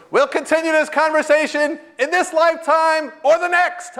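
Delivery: mock-booming parting call, pitched high; high, loud proclamation. Spiritual leader calls back from the door, proclaiming loudly. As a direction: call it out gleefully across a room.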